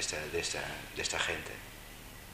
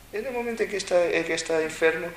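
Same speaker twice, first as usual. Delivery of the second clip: raised much higher and louder; sudden high, louder emphasis; 12 semitones higher, 7 dB louder, faster than usual. Real, not acted. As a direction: lift the voice high to set up the scene. Building up the sick person story.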